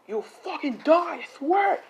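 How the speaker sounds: high, strained threat; acted pissed-off yell. Deku to the guy he is beating, acted furious threat. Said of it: "he's pissed".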